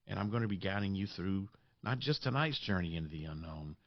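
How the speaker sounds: usual speaking voice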